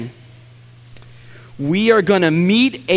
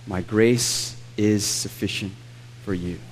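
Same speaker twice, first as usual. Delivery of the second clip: lower, softer tender reading; soft quoted promise; 6 semitones lower, 7 dB quieter than usual. Acted. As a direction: let the promise land quietly. Repeats God's words softly.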